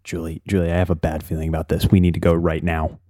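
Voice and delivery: urgent low whisper. Acted. Wes warning Julie to leave at once, low and urgent. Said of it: "Wes leans into Julie and goes".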